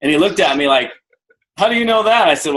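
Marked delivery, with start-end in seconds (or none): 1.6-2.3 surprised. Retold or imitated. Skier surprised at his nickname.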